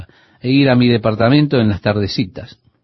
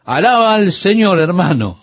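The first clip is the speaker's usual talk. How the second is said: high, lightly imitated operatic exhortation; mock opera voice; 5 semitones higher than usual. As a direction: exhort in a mildly hammed-up opera voice. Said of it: "con esa voz de ópera, ella decía".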